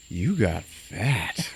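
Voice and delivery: very slow, flat-toned put-down; deadpan jab. Retold or imitated. Friend retelling a movie, voicing a deadpan insult. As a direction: drop it slowly and bluntly.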